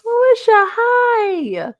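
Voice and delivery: high, loud sing-song hello; excited greeting. Real, not acted. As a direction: greet her like a long-lost friend. Greeting a chatter by name.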